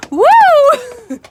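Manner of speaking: high, loud whoop. Real, not acted. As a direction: let out a joyful whoop. Cheering at the chickens.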